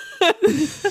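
hohes, lautes Auflachen; laut und hoch loslachen; echtes lautes Lachen. Real, not acted. Lacht über die Videoszene. (schallendes echtes Gelächter)

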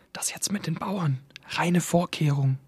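leise geflüstert, fast tonlos; raunend, damit es keiner hört; flüstern. A narrator's reading. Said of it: "flüsterte jemand". (jemand im Publikum flüstert seinem Nachbarn zu, leise)

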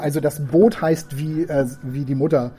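ordinary talk

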